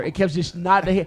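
ordinary talk, nothing marked

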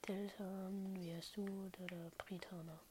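soft unvoiced whisper. Real, not acted. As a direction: whisper it under your breath. Whispering names while gaming.

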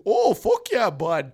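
loud, high mock Canadian; hammed-up Canadian accent, hollered. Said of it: "the most Canadian accent".

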